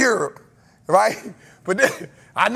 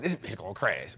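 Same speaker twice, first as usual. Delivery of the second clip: low, much softer muttered fear; muttered dread; 12 semitones lower, 11 dB quieter than usual. Retold or imitated. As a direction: mutter it under your breath as if the plane is going down. Repeats the retold fear.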